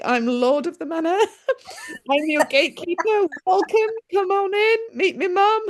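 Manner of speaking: a lifted, laughing mock welcome; playful lord of the manor. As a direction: play the son grandly welcoming Mum in. Acting her son as host.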